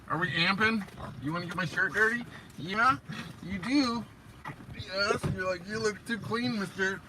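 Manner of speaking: high, teasing voice for a dog; high-pitched doggy talk; playful pet talk. Real, not acted. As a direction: coo questions at your excited dog.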